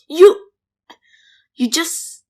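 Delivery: sudden loud outburst at mom; yell at mom. Acted. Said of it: "no reason to yell at me".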